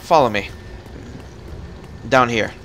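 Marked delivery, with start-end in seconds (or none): none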